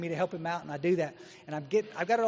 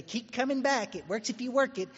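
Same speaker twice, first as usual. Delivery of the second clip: "Keep coming back" chanted higher like a rote slogan; sing-song slogan; 7 semitones higher than usual. Retold or imitated. AA members reciting the closing slogan in a rote sing-song. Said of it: "everybody will say".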